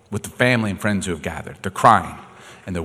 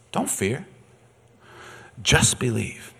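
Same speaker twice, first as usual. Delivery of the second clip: gentle reassurance; 2 semitones higher, 7 dB quieter, slower than usual. Retold or imitated. Jesus speaking gently to grieving father.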